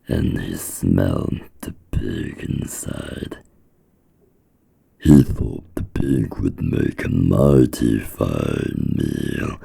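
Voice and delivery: ordinary delivery, nothing marked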